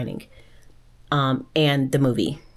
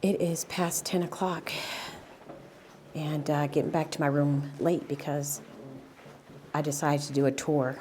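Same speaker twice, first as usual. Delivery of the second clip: quiet; 9 dB quieter than usual. Real, not acted. Back at hotel late at night.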